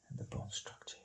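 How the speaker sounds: whispered under his breath; a soft private whisper while drawing. Real, not acted. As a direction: murmur it to yourself as you work. Artist murmuring while drawing.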